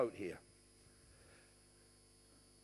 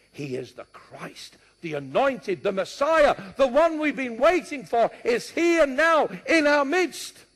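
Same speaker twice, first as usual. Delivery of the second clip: building proclamation; 8 semitones higher, 10 dB louder than usual. Acted. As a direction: build to a triumphant preacher's declaration. Acted crowd proclaiming the Messiah.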